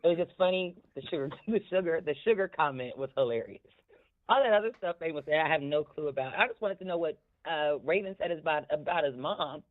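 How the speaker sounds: usual speaking voice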